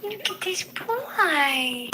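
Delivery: high, slow, drawn-out coo. Real, not acted. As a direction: gush over an adorable animal. Fussing over a dog.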